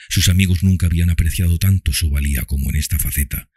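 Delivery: usual speaking voice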